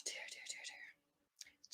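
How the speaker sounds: weary whispered sighs. Real, not acted. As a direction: breathe it out as a whisper. Sighing over the thunders.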